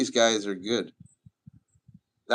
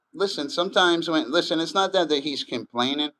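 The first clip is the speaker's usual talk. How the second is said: insistent; 6 semitones higher, 5 dB louder than usual. Real, not acted. Urgent correction of a viewer.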